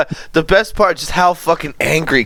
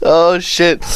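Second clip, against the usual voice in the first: ordinary talk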